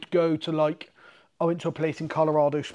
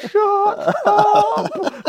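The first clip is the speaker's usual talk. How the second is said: high, loud squeal of shut up through a laugh; giggling shut up, high and loud; laughing embarrassed protest; 7 dB louder than usual. Retold or imitated. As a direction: squeal in mock embarrassment, much higher. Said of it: "So I'm like".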